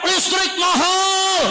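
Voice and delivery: drawn-out high cry of complaint; mock crowd cry. Preacher voicing the people crying out about prices. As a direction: wail it slowly like a protesting crowd.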